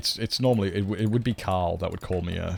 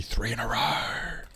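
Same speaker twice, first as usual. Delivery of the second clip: slow, breathy whisper of delight; 3 semitones higher, 4 dB quieter, slower than usual. Real, not acted. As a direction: hush it out slowly to yourself. Excited aside under breath.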